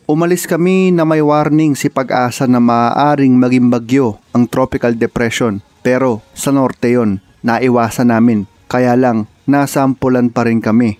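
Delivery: loud storytelling narration; voiceover narration. Acted. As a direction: narrate the trip like a documentary. Vlog narration, louder.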